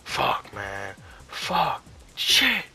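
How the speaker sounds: mock raging, climbing to high, loud curses; retold rage. Gamer recalling how they used to rage at everything, acting pissed off. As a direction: imitate an angry gamer's escalating swearing. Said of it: "I used to get pissed off".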